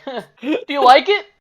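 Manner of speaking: high, loud mocking do-you-like-it; high mocking gift giver. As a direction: tease like a smug prankster. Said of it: "It's like".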